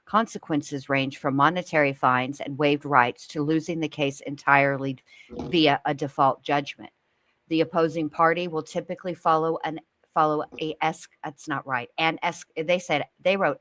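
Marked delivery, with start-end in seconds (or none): none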